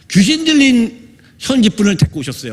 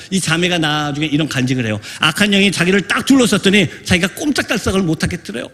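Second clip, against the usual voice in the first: ordinary talk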